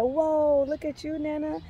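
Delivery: amazed. Retold or imitated. Amazed at healed Nana in dream.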